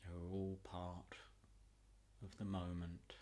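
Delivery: soothing relaxation voice, long pauses between phrases; guided meditation. Acted.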